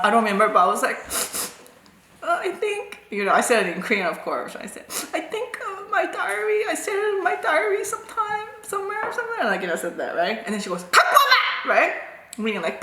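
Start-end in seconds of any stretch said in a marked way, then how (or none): 2.2-3.0 high, soft mock whimper; timid pupil. Pupil making excuses to angry teacher, high soft timid imitation. 5.1-9.4 high, soft, whimpering imitation; timid pupil. Pupil explaining her diary to angry teacher, high meek mock whimper. Scared pupil's hesitant excuse. 10.9-11.7 high, sharp mock yell; commanding teacher. Teacher ordering pupil to bring it, high imitated yell.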